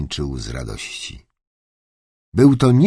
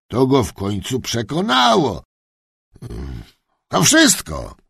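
higher-pitched, put-on old gentleman's voice; old duke pondering; 6 semitones higher than usual. Duke telling his granddaughter about the sale, pondering. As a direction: think aloud, then explain self-assuredly.

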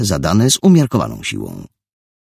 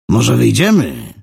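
louder, higher, daring; loud challenge; 7 semitones higher, 5 dB louder than usual. Acted. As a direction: dare him outside. Thug inviting fight.

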